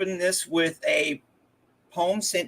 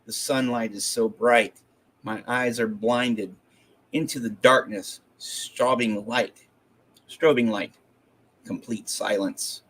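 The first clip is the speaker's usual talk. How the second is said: lower-pitched, measured poem recital with pauses between phrases; poetry reading; 5 semitones lower than usual. Acted. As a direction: recite it in short separate phrases, each settling low. Reads a poem aloud.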